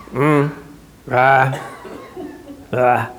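short low grunts in place of an answer; grunting noises. Retold or imitated. Tired husband grunting answers at wife. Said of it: "they make noises".

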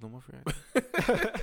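says it again through a laugh. Real, not acted. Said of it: "repeats louder, laughing".

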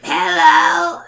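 very high, loud, exaggerated bratty kid voice; overdone cartoon kid smoker squawk; bad Stinko voice. A narrator's reading. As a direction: belt it out as an absurd little goblin boy. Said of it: "I'm making his voice worse and worse".

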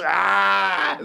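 drawn-out mock rage cry; berserker yell. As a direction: let out a comic berserk scream. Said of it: "Going in berserker rage".

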